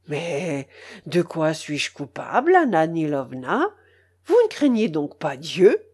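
lightly pleading uncle, voice dropped low. A narrator's reading. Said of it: "d'une voix suppliante".

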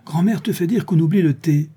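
high-pitched boy's shout, lightly voiced; calling out. A narrator's reading. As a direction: call out the message like a young boy. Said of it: "cria Pierrot qui les sépara".

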